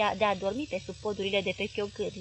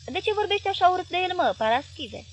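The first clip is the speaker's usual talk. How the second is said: higher, louder upset reproach; finally upset; 9 semitones higher, 5 dB louder than usual. Acted. Wife finally upset with her husband, higher reproach. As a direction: scold him, at last hurt. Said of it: "se supără în sfârșit țiganca".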